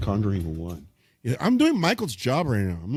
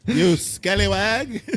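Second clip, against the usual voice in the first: put-on slow, raised old-timey cry; hammy pirate insult; 6 semitones higher, much slower than usual. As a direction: drag it out like a stage pirate.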